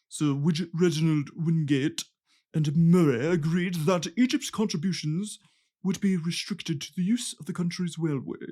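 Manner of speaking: put-on posh British official; posh British accent. Podcaster imitating British officials' agreement, in a posh British accent. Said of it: "I'm sure they said it in a posh".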